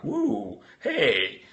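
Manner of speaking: high, hammed-up stoner whoop; mock an old hippie high on pot; stoned hippie. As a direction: sketch of a dazed pot smoker cheering. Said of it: "For me, that's kind of like".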